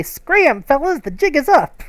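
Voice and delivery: little bug mobsters telling the player off; cockroach gangsters. Acted. Cockroaches talk as tough guys.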